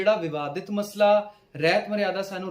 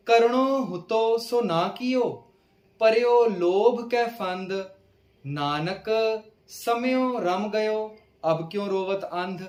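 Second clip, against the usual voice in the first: slow, measured verse reading; scripture recitation; 3 semitones higher, 4 dB louder, much slower than usual. Acted. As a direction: recite each line slowly, stretching the words.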